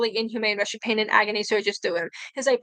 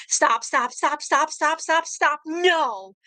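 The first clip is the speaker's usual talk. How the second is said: higher, desperate pleading stops; frantic higher cries of stop; agonized begging; 5 semitones higher, much slower than usual. Acted. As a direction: plead as the pain builds. Victim screaming in agony.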